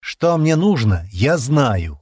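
slow, loud, emphatic rebuff; cold cutting retort. A narrator's reading. Стэн rebuffing Джемма, coldly final. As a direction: cut the argument off coldly and loudly. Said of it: "холодно отрезал Стэн".

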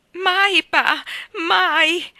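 high, loud tearful pleading; between sobs. A narrator's reading. Mrs Taylor sobbing to calm her husband, high and loud. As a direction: cry out the denial. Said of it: "tra i singhiozzi".

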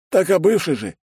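high-pitched, protesting retort; cheeky correction. A narrator's reading. Secretary correcting his jealous boss, high and cheeky. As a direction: shoot it back high and innocent.

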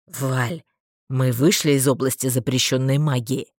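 low, tense question; through the teeth. A narrator's reading. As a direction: ask it through gritted teeth. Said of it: "сквозь зубы спросил Марстон".

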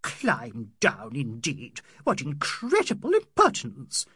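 scoff, then burst out in outrage; affronted outcry, climbing in pitch; indignant cry. A narrator's reading. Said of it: "Missus Turton cried".

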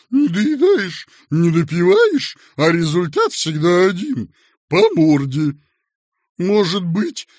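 loud, high, wailing self-pity; bitter, humbled lament. A narrator's reading. Humiliated boss inviting another beating, bitter lament. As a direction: wail like a long-suffering martyr. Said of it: "сказал добрый и униженный Дыркин".

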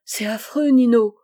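despairing cry of Chantal to Nino. A narrator's reading. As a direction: call out his name in despair. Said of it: "Chantal s'écria".

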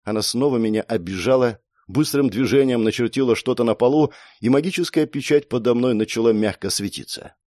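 usual speaking voice

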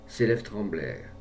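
plain reading voice

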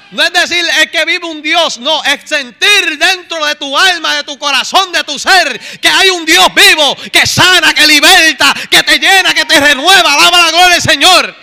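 impassioned sermon, building to a loud peak; fervent preaching. Real, not acted. Preacher urges congregation to feel a living God, fervent. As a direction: drive each phrase harder, like a revival preacher. Preaches at rising full force.

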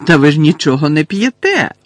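loud, merry cry; cheerful exclamation. A narrator's reading. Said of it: "вона весело вигукнула". (весело вигукнути)

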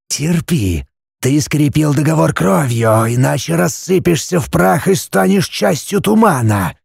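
Basilisk's voice. A narrator's reading. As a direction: hush it like an ancient serpent.

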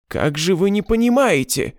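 high anguished cry; desperate outcry. A narrator's reading. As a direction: plead at full voice. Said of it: "вскричал Михельсон".

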